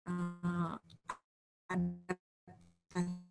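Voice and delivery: choppy, dropping-out connection; glitchy jumping pitch; breaking up. Real, not acted. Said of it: "Suaranya putus-putus".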